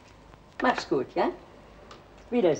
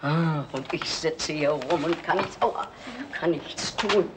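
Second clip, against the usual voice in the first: ordinary delivery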